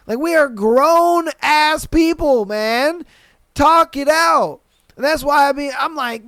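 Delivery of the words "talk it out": drawn out, each word pressed and louder; slow emphatic urging. Real, not acted. Urging coworkers to settle conflict, slow and emphatic. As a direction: spell it out slowly for grown adults. Ranting at coworkers about talking.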